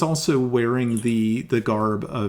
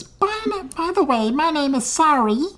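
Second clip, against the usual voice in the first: high put-on gnome voice, accented; gnomish woman ambassador. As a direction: introduce yourself in a high, odd character voice. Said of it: "I'm trying to do voices".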